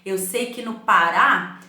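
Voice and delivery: ordinary talk, nothing marked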